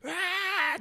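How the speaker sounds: short high-pitched crowd shriek; high mock roar; mock crowd scream. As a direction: imitate a screaming crowd in one burst. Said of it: "everyone's fucking screaming".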